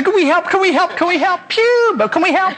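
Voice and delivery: put-on high, excited children piping up; eager young siblings. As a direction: plead to help like clamouring little kids. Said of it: "all the brothers and sisters gather around and say".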